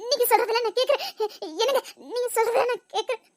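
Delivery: half-laughing, half-sobbing promise; laughing through tears. Acted. Wife promising her husband, laughing through tears.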